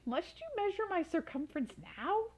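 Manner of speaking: a pitiful dragon pleading; whimpering dragon. Acted. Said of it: "Circumference whimpered".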